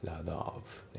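"ラダオブ" said as one word with a D-like T; linked pronunciation. Shown as a demonstration. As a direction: quietly repeat "ラダオブ", words fused. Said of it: "リンキングで".

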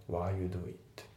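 "while you do it" with while as a plain wa; reduced while. Shown as a demonstration. Teacher repeating the correct take, reduced "while you do it". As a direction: run "while you do it" together without the l. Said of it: "わーっていう感じで".

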